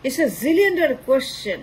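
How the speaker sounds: very slow, each word stretched for effect; slow, deliberate "zillion dollar question"; drawn-out rhetorical punchline. Real, not acted. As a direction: lean on it like a punchline.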